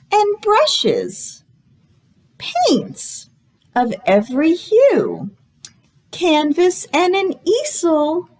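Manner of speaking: bright, sing-song storytime read; reading to children. Acted. Librarian reading a guessing book to children, sing-song. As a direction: read it like a picture book to little kids.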